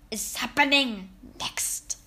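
whispered witch question; teasing whisper. A narrator's reading. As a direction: tease them with a hushed secret.

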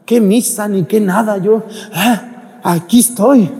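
high, loud mock refusal; dismissive mimicry. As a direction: imitate someone scoffing at going to church. Mimics people who skip Mass for business.

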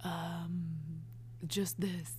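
slow, low husky voice; sexy voice. Shown as a demonstration. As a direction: drop into a slow sultry rasp. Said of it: "Sexiest voice".

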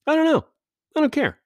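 squeaky high mock voice; mock airhead voice. Mocking the careless parent in a high ditzy squeak. Mocking the woman in his head.

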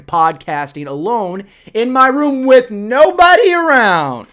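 comic outburst of self-pity, stretched out at the end; mock anguished yell. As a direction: build to a high, loud wail. Mock lament about being alone.

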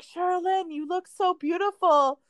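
high, gushing mother's voice, a touch tearful; mom gushing through tears. Retold or imitated. Mom gushing over the bride in her dress, high and tearful. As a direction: coo over the bride like a proud weepy mom. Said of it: "my mom was, like, crying".

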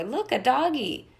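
a hint of a child pointing at a dog, slowly; little boy. A narrator's reading. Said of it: "said the little boy".

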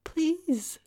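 very high, falling plea; begging. A narrator's reading. Tara to Mike as she is held, begging. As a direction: beg in a little-girl voice. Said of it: "Tara begged".

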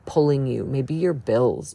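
ordinary talk, nothing marked

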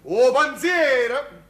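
exasperated exclamation. Acted.

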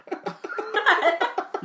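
high breathy laugh; high giggle. Real, not acted. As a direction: burst out giggling. Laughs at own joke.

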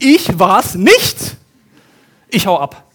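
loud, slow, desperate denial, stress on ICH; Joseph at his limit. Retold or imitated. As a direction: throw up your hands and bolt. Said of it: "der denkt sich". (Josef am Limit, laut und langsam, Betonung auf „Ich“)

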